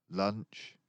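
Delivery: ordinary delivery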